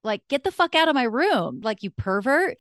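put-on high, teenage-style outrage; imitated indignant eighteen-year-old self; disgusted yelling. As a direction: recoil and order the creep out. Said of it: "I was just yelling at him".

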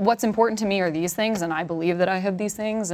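ordinary delivery

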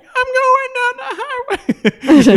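very high, slow squeaky singing; slow falsetto pig voice through laughter; high-pitched voice. Retold or imitated. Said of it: "in a high-pitched voice".

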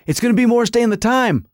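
high, fast giddy fan reaction; acting out a thrilled fan's racing thoughts; retold excitement. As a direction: gush in a rush of anticipation. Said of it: "I'm like".

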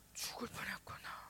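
frightened whisper. Retold or imitated. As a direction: breathe it out in fear. Frightened pastor's wife whispers. (겁에 질린 속삭임)

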